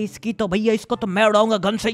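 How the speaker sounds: normal talking voice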